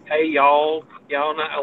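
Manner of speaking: a hint of a hammed-up Southern drawl, mocking the cliché; mock Texas twang. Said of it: "some kind of twangy".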